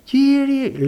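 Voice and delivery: high, strained call in a different timbre; a high, strained, lightly voiced cry of Κύριε; imitated cry to the Lord. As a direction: mimic the wife crying out Κύριε. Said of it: "τόσο δυνατά".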